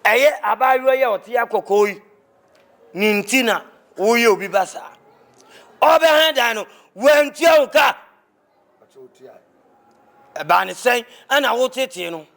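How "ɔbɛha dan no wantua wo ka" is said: higher, louder accusing shout; angry accusation. Acted. Elder accusing the young man, a loud shout. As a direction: shout the charge at him.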